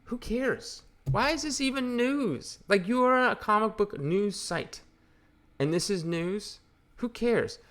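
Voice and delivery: mock the headline with high disbelief; exasperated disbelief. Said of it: "And I just read this and I'm like".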